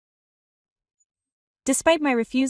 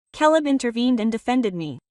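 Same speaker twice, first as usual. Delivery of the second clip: usual speaking voice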